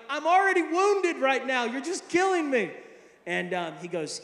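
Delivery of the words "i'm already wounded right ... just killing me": high, loud hammed-up anguish; exaggerated hurt, pitched up; mock wounded protest. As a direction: cry out as if being stabbed. Acted wounded protest to mentor.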